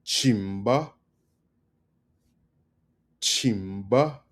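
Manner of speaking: "Chimba" with a held CHIM, rising at the end; stress on CHIM. Shown as a demonstration. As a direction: stretch CHIM, then lift the ba of Chimba. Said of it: "emphasis on the chim".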